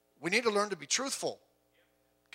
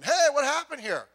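higher, louder demand; stern confrontation; 9 semitones higher, 6 dB louder than usual. Retold or imitated. Pastor confronting a lodger about what happened, higher and louder. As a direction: call the kid out sharply. Pastor questioning a lying kid.

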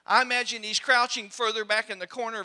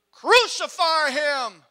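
loud, slow, drawn-out demand; mob yelling; 3 semitones higher, 8 dB louder, much slower than usual. Acted. Preacher voicing the crowd crying for crucifixion, loud. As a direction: roar it like a furious mob. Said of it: "And the crowd said".

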